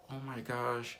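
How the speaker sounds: high, excited gush; high excited imitation of the store lady; delighted lady. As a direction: squeal it with joy. Lady overjoyed at Russian.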